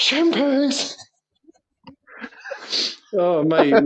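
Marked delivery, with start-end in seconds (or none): 0.0-0.9 high-pitched imitated yell, no real volume; mock group shout. Schoolboys defying their teacher on the bus, mock shout. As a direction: pipe it up high in mock defiance. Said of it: "all the lads on the bus just shouted out".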